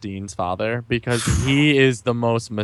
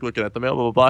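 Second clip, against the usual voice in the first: ordinary talk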